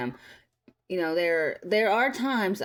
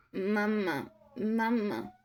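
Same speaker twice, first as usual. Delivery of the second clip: higher-pitched mock baby calling for mama; imitated crying baby; 4 semitones higher, slower than usual. Retelling a video where baby cries for mama, imitated baby voice. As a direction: lightly imitate a fretful baby. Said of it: "the baby is crying".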